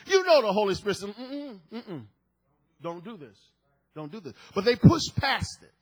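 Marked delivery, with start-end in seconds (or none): 1.1-4.3 soft, lowered warning voice; hushed warning. Acted. Holy Spirit cautioning schemers, hushed warning. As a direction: quietly caution them not to go ahead.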